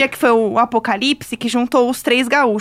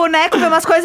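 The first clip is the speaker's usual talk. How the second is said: normal talking voice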